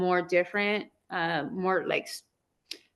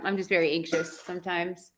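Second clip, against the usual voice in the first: ordinary delivery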